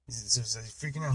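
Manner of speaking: ordinary talk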